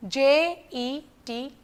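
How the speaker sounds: J E T, slow letters for the class to echo; slow choral spelling. Shown as a demonstration. Teacher leading children in spelling, slow letters. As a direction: lead the class through J E T slowly. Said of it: "All of you say".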